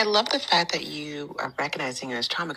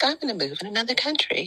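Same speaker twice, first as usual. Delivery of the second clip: put-on higher-pitched slip into a British accent; 8 semitones higher than usual. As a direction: let a British lilt creep into the quote. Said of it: "the British accent comes up".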